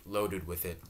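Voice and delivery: usual speaking voice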